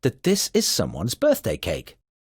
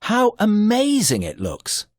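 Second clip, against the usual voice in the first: higher, amazed exclamation; 8 semitones higher than usual. Shown as a demonstration. Teacher demos an exclamation, amazed about the cake. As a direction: marvel at the cake.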